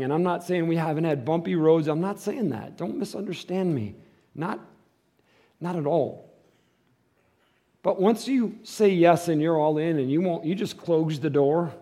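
ordinary talk

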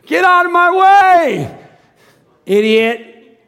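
high, loud, slow hammed-up yell; mock angry shout. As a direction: bellow at the slow car ahead. Acting a rude driver.

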